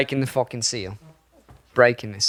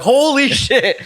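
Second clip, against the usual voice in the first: high, slow, laughing disbelief; laughing holy shit; 9 semitones higher, slower than usual. Retold or imitated. Retelling a big cat attack, laughing amazed curse. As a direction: stretch it out, laughing in shock. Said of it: "You're like".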